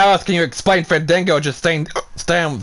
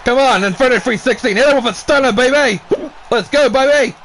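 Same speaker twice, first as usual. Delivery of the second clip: hyped wrestling commentary, higher and pushing; play-by-play call; 4 semitones higher than usual. Acted. Commentator urging Disco Inferno to fight back, excited play-by-play. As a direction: cheer the wrestlers on like a ringside announcer.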